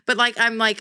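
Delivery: normal talking voice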